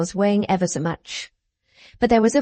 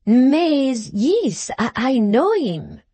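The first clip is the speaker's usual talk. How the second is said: put-on French accent; 5 semitones higher, much slower than usual. A narrator's reading. Foreigner misunderstands, French voice.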